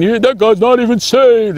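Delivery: high, strained mocking voice; mock name-calling. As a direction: imitate someone slinging accusations. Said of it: "they were calling each other names".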